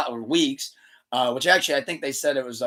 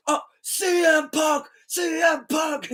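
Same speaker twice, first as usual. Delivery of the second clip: put-on very high, slow drunken cheer; drunk fan cheer; 14 semitones higher, slower than usual. Drunk fan finally cheering for Punk, high and slow. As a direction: shout the name like a tipsy fan. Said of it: "you see the guy goes".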